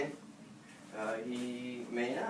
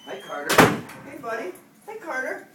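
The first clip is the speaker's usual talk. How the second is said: much higher and louder, gushing hello to the dog; excited dog greeting; 12 dB louder than usual. Real, not acted. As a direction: greet the dog like you're thrilled to see him.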